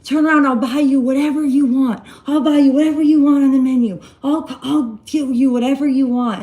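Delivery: high, loud pleading yell, acting out the boyfriend; imitated screaming. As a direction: beg loudly like a panicked boyfriend, fast. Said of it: "screaming in the car".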